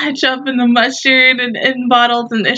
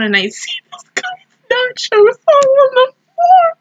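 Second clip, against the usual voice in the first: laughing while telling. Real, not acted. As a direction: voice climbing high with laughter. Cracking up at spilled nachos.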